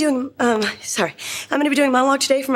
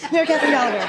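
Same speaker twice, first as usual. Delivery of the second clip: laughing; 3 semitones higher, much faster than usual. Acted. Laughing nervous announcement.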